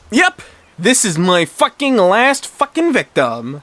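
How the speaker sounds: high, slow, drawn-out boast; acted bratty gloating. A narrator's reading. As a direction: stretch the curses out in triumph. Martha claims last victim.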